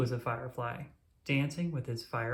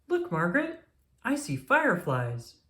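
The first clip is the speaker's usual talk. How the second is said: excited exclaim; 7 semitones higher, 3 dB louder than usual. A narrator's reading. Daniel shows his sister the fireflies, excited high voice. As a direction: point out something wonderful. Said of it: "Daniel exclaimed".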